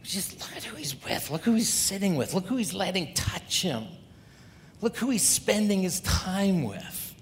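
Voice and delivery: a preacher mimicking sniffy Pharisees; Pharisees muttering. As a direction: play a disgusted religious snob gossiping. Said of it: "the Pharisees are muttering".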